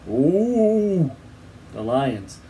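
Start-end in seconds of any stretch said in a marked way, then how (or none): 0.1-1.1 high, long imitated moan; moaning like an alarmed camel; rising and falling animal wail. A narrator's reading. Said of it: "reared up on their back legs and moaned".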